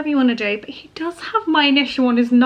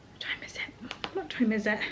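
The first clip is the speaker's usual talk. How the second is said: whispered question to herself; muttering under her breath; soft whisper, asking the time; 13 dB quieter than usual. Real, not acted.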